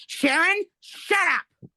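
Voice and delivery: imitating sister's high, loud outburst; mimicked yell, pitch leaping up; retold angry shout. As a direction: shout it high, cutting someone off. Retold sister shutting her down.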